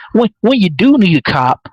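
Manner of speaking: ordinary delivery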